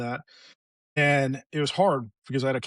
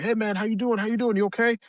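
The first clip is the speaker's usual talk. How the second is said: higher-pitched, casual check-in; imitated concerned friends; 7 semitones higher than usual. Friends checking on injured buddy, imitated casual concern. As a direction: lightly act out buddies asking after you.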